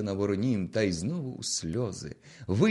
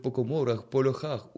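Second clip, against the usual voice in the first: usual speaking voice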